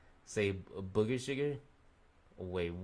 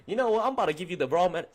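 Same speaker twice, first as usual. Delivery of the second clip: mock rant; 9 semitones higher, 7 dB louder, faster than usual. Mock rant at a rude patient.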